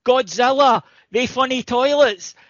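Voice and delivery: put-on high, enthusiastic drunken gushing; drunk guy. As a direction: gush like a tipsy Glasgow bloke. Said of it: "And the wee guy goes".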